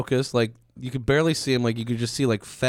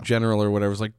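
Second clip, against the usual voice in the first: normal talking voice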